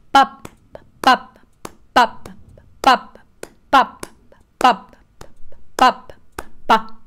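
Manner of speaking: sharp loud pops on each accent; accented rhythm syllables. Shown as a demonstration. Teacher demonstrating accents to a piano student, loud pops. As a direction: bark the rhythm, accents strong. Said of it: "Try to imitate what you are doing with your voice".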